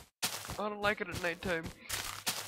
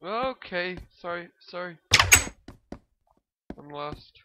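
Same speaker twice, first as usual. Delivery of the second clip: ordinary talk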